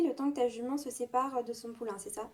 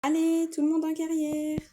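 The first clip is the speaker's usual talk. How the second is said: louder and higher, calling across the yard; 6 semitones higher, 6 dB louder than usual. Acted. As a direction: summon the riders to the arena. Instructor calling pupils to arena. (appel fort à tout le groupe)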